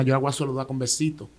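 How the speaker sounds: ordinary talk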